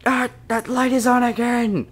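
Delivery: high, loud alarmed yelp; panicked shriek. Acted. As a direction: shriek when caught out. Catches the camera light again.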